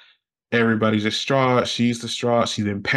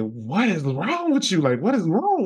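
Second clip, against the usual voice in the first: higher, swooping worried question; retold alarm; 8 semitones higher than usual. Child to screaming mother, alarmed high question. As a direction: plead to know, pitch leaping up.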